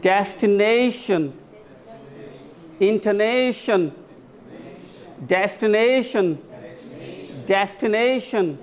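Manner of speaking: each word stretched on its "ने" syllable; syllable drill. Shown as a demonstration. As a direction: model डेस्टिनेशन and इंटरनेशन with a long, stressed "ने". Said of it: "syllable use किया जा रहा है". (डेस्टिनेशन, इंटरनेशन में "ने" पर ज़ोर देकर)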